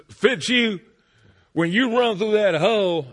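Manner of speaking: loud, high barking coach voice; yelling coach. Retold or imitated. Coach yelling instructions at a ten-year-old fullback. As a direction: bark it like a football coach. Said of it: "the coach said".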